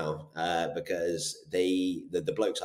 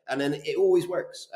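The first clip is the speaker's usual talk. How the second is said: usual speaking voice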